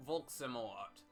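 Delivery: ordinary delivery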